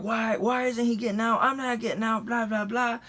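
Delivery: high-pitched mimicked bickering; mock argument. Cop and buddy arguing over getting out, mimicked. Mimicking back-and-forth yelling.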